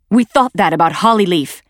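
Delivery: bitter retort to Lionheart's reassurance; sharp retort. Acted. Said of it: "Blue Star retorted".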